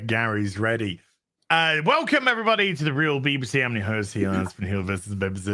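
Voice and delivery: usual speaking voice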